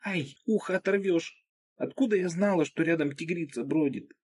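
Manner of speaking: put-on high, whiny girl's voice; young girl voice. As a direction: squeal it like a girl in pain. Narrator voices girl whose ear is pulled.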